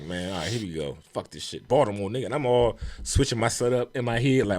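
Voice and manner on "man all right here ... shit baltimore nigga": low, soft muttering to himself; resigned self-talk. Retold or imitated. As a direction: mutter it like a reluctant pep talk. Dejected self-talk before going on.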